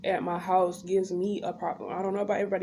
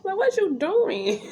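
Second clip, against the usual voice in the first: high indignant question; 11 semitones higher, slower than usual. Retold or imitated. Continues quote.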